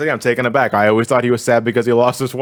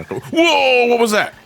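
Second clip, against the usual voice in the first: startled; 13 semitones higher, much slower than usual. Real, not acted. Sudden glitch in the game.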